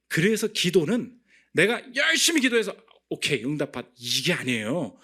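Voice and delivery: high, loud preaching; full-force preaching. Acted. Preacher urging the congregation on prayer, full force. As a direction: drive the point home to the pews.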